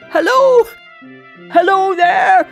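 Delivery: high, loud hallooing; joyful calling out. A narrator's reading. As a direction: holler a happy greeting. Scrooge calls out joyfully.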